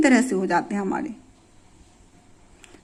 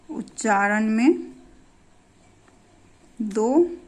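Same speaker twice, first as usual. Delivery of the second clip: slow and softer, one word at a time with long pauses; slow dictation; 5 dB quieter, slower than usual. Shown as a demonstration. Teacher dictating the definition to her students, slow and word by word. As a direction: read it out word by word so the class can write it down. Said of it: "repeats the definition for writing".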